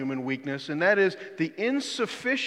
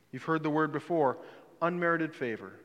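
normal talking voice

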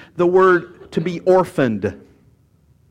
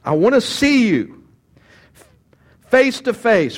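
higher and louder, yearning; longing Paul; 7 semitones higher, 4 dB louder than usual. Acted. Preacher voicing Paul's wish to see the church, yearning and raised. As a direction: reach out as if to hug them. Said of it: "That's how I feel".

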